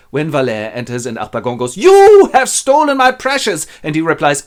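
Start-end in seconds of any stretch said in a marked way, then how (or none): 1.8-3.7 drawn-out, high, very loud cry; furious accusation. Acted. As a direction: accuse with hammed-up outrage. Miser accuses the thief.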